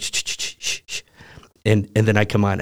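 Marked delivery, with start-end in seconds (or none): 0.0-1.0 shushing. Retold or imitated. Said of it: "and I'm like".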